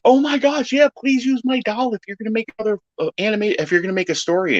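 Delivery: high, fast, squeaky fan request; a light squeaky imitation of a fan; mock fan voice. Quoting an eager fan, in a mock squeaky voice.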